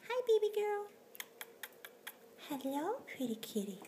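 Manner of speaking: very high squeaky cooing. Real, not acted. High baby talk to kitten.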